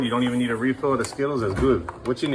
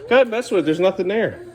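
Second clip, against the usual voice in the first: normal talking voice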